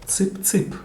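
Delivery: high, quick chirpy call to hens; demonstrated poultry-feeding call; chicken call. Shown as a demonstration. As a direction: call the chickens over in little sharp bursts. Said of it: "this is the sound that you make to call".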